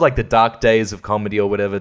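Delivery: ordinary talk, nothing marked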